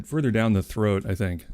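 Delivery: ordinary delivery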